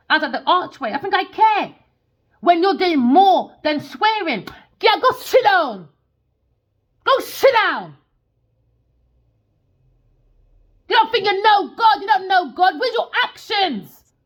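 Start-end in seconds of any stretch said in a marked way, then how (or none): 2.4-14.1 high, loud, furious telling-off; angry shouting. Real, not acted. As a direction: shout her down. Angry rant at a critic.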